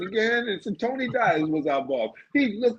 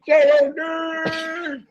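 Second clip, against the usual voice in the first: long drawn-out shout across the street; calling out a name; 11 semitones higher, 4 dB louder, much slower than usual. Retold or imitated. Calling to mommy down the block, long stretched shout. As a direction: call her name high and stretched.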